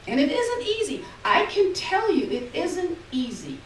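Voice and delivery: put-on high, loud, earnest old mother; an old mother telling the nurse that life is hard; old Ma insisting. A narrator's reading. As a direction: press the point like a proud old woman. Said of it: "she says".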